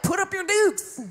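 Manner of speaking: high-pitched scrappy challenge; mock fighter's challenge. Believer squaring up to fight God, mock feisty challenge. Acting out someone picking a fight.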